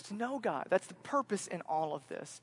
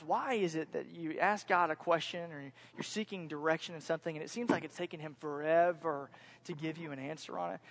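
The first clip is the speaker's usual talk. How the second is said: normal talking voice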